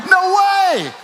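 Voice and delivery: high, loud disbelieving yell; shocked shout. Retold or imitated. Preacher shouting his disbelief at an untrained runner. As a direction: shout it like you can't believe it.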